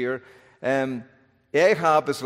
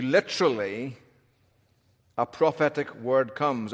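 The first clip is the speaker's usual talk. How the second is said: ordinary delivery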